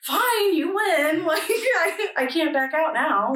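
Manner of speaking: high, laughing mock surrender; amused retold capitulation; overwhelmed surrender. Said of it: "really overwhelmed".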